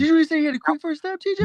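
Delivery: incredulous. Real, not acted. Incredulous at TJ's claim.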